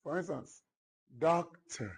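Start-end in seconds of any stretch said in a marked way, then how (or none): 1.2-1.9 "doctor" with a strong, higher DOC and weak tor; first-syllable stress. Shown as a demonstration. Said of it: "you apply more strength to it".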